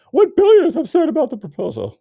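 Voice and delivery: high, loud sarcastic announcing; mocking headline read. Reading the billionaires' headline aloud, loud high mockery.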